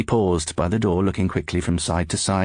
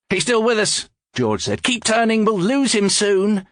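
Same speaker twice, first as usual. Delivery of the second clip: urgent; 13 semitones higher than usual. Acted. George urging driver in chase.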